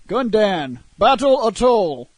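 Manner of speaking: "Gundan, Battle Atoll" said with Gundam as Gundan, lightly mimicked; a hint of a game announcer mangling "Gundan, Battle Atoll"; garbled announcer. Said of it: "announcer dude that couldn't talk".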